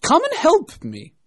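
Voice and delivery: high, loud, slow command; angry rabbit. A narrator's reading. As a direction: order the servant over, shouting angrily. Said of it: "said the rabbit angrily".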